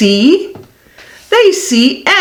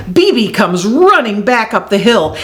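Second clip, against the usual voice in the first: ordinary delivery, nothing marked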